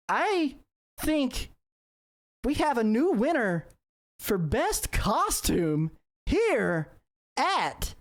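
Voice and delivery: high, slow, drawn-out announcer call; milk each word like an emcee on stage; contest announcer. Acted. Contest host on the mic crowning a winner, slow and high.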